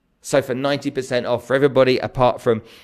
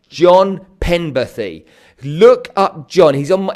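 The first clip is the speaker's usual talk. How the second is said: normal talking voice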